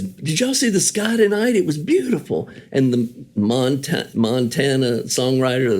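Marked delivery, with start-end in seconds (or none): 0.0-2.5 higher, gushing mock voice; gushing Texan. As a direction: gush over the sky like a starry-eyed local. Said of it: "and says".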